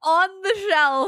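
loud, high, slow echo; mock outrage. Friend echoing the boss's insult, loud scandalised disbelief. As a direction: repeat it aghast, stretched out and loud.

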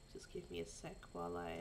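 hushed whisper to herself. Real, not acted. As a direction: whisper it while busy. Sorting entrants, talking to self.

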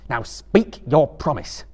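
slow, solemn command; stern Frodo. A narrator's reading. Frodo demanding Gollum's promise, slow and stern. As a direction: demand the oath slowly. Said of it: "said Frodo".

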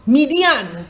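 "Midian" drawn out, rising then falling on the ending; three clear syllables in "Midian", ending in a long AHN; shown pronunciation. Shown as a demonstration. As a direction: say "Midian" as mee-dee-AHN. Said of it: "it's pronounced".

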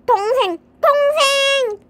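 high, drawn-out complaint; exasperated. Acted. Brother fed up with his little sister, exasperated. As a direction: build to a stretched, fed-up cry.

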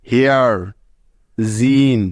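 a clear long ee sound; each word separate, as a model; English example words. Shown as a demonstration. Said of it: "যেমন দেখো". (ঈ ধ্বনি স্পষ্ট করে দেখানো)

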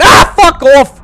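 loud startled curse; scared yell. Real, not acted. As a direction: yell it as something jumps you. Jump scare in horror game.

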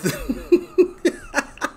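high burst of laughter; high-pitched cackling laugh. Real, not acted. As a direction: crack up at your own joke. Cracking up at his own reading.

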